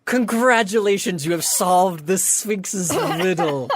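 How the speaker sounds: high start, then slowing grandly; sphinx proclamation. Acted. Sphinx opening the door to winners, grand proclamation. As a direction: proclaim it with ceremonial flourish. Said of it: "opens the door and she goes".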